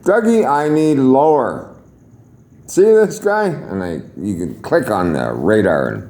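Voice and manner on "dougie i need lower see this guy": hollering across the room, high. Retold or imitated. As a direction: call out to Dougie, urgent. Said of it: "hollered down at a low-level guy".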